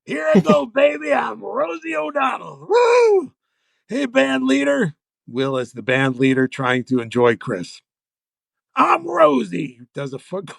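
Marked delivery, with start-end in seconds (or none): 0.0-4.9 Farley yelling as Rosie. Retold or imitated. Writer acting Farley bursting out as Rosie, yelling. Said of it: "Chris Farley yelling, no impression at all". 8.8-9.8 mock Rosie yell. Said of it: "Chris Farley yelling".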